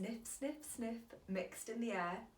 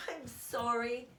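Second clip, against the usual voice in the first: sniffled; 3 semitones higher, 4 dB louder, slower than usual. A narrator's reading. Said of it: "he sniffled".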